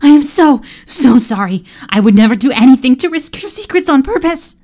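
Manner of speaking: high, loud, tearful pleading; crying servant pleading; desperate cry. Acted. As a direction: beg for mercy.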